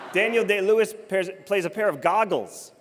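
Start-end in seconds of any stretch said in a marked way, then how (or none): none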